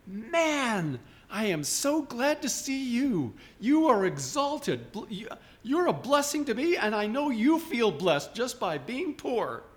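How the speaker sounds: high, drawn-out, hammed-up joy; sing-song, over-the-top enthusiasm in a high voice; mock gushing delight. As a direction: gush slowly and grandly, as if overjoyed to see them. Acts out gushing greeting to beggar.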